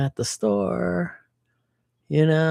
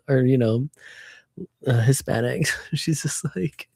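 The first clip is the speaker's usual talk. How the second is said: ordinary delivery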